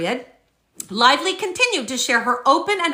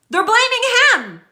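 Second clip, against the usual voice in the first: exasperated; 10 semitones higher, 4 dB louder than usual. Real, not acted. Exasperated about the producers.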